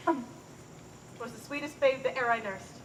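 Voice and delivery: soft, slow, low reminiscence; fond tenderness. Acted. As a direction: hush down into doting affection. Nurse fondly recalls baby Juliet.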